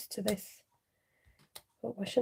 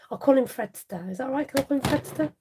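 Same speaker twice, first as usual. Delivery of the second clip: ordinary talk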